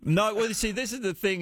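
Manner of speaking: normal talking voice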